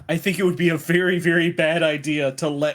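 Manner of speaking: fast and loud urgent warning. Real, not acted. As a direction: hurry to stop them. Player urgently warns the table.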